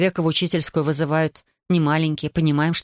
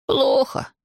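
put-on higher, mildly sighing boy's reply; 7 semitones higher than usual. A narrator's reading. Ivan answers his teacher about his bad life, sighing. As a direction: say it on a sigh, pitched up. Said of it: "Иван опять вздохнул".

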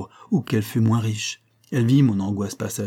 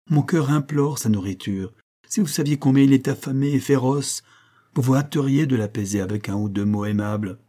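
plain reading voice

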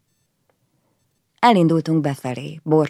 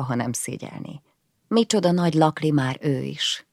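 plain reading voice

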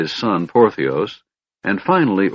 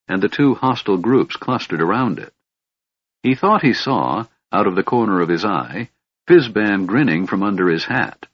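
ordinary delivery, nothing marked

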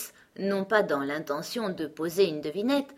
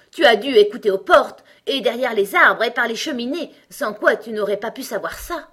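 loud, raised, indignant tirade; angry accusation; 5 semitones higher, 10 dB louder than usual. A narrator's reading. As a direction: accuse the child of eavesdropping, furious. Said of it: "se mettant brusquement en colère". (accusation en colère)

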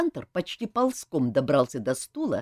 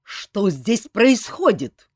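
loud, slow bark, raised in pitch; barking colonel; 5 semitones higher, 7 dB louder, slower than usual. A narrator's reading. Colonel bursts in on a brawl, barking. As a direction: bark it out like a commanding officer. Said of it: "с порога рявкнул полковник Сур".

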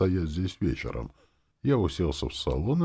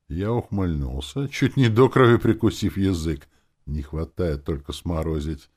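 usual speaking voice

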